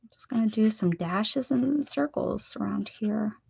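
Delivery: soft unvoiced whisper. Real, not acted. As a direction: whisper it under your breath. Whispering while drawing.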